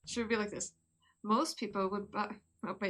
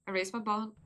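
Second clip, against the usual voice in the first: usual speaking voice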